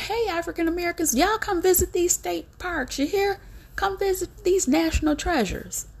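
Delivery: high, fast, sugary sales pitch mocking the government; imitating a government ad voice with syrupy cheer; mock sweet invitation. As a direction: sweet-talk them like a fake friendly ad. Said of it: "and they said".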